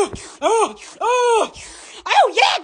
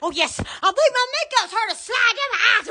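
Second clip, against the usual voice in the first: ordinary talk, nothing marked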